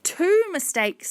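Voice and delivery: put-on high, drawn-out girl's reply; high-pitched, deliberate child's voice; earnest child. A narrator's reading. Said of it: "said Hannah".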